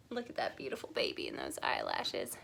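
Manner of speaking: low, soft, cooing over the picture; tender admiration. Real, not acted.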